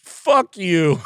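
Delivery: put-on high, loud taunting old man; small old carpenter taunting the crew, loud; defiant yell. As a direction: shout it up in their faces. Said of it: "He's like".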